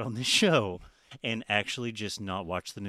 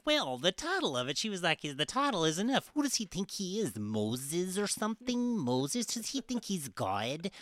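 mocking Sarah Palin; 8 semitones higher than usual. Host mocks a politician's interview answer, higher mocking voice. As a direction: sneer it like a scornful politician.

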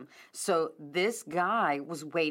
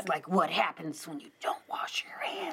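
hushed, eerie whisper; spooky whisper; 3 dB quieter than usual. Pretend. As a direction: whisper it like a ghost story. Teasing the spooky covid room.

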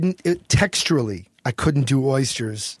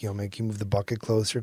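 quiet ask; 5 semitones lower, 7 dB quieter, faster than usual. Retold or imitated. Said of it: "didn't wanna outwardly shout".

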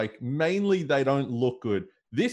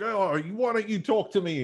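mock outrage; 8 semitones higher than usual. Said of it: "And he's just outraged".

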